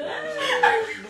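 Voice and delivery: high-pitched giggling burst; high giggle. Real, not acted. Laughing at joke.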